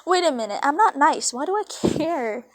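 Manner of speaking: usual speaking voice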